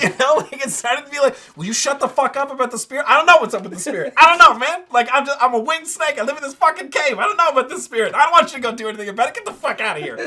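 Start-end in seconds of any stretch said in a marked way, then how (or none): 1.6-3.1 pissy coatl. Retold or imitated. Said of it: "starting to get kinda pissy". 3.1-10.2 irritated rant, louder and higher; a fed-up snake telling the party off; pissy coatl. Retold or imitated. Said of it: "starting to get kinda pissy".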